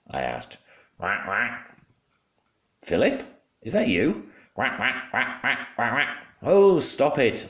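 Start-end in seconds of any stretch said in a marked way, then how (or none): 1.0-6.1 quacked like a duck; a string of duck quacks; duck quacking. Acted. Friend turned duck answering the phone, quacking. 6.4-7.4 higher, slow, louder plea; exasperated cry. A narrator's reading. Girl on the phone to the quacking friend, exasperated cry. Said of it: "I cried".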